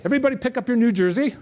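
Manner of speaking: ordinary talk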